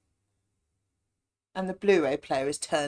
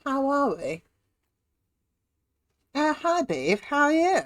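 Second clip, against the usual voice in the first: mock posh greeting, pitched up; hammed-up posh English greetings; 8 semitones higher than usual. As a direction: put on a plummy posh voice. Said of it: "but we'll be posh for a moment".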